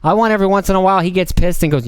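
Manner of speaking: usual speaking voice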